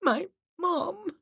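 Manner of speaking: high, choked words through sobs; muffled sob. A narrator's reading. Miranda answers the medium, high and sobbing. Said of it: "Miranda sobbed and replied in a muffled voice".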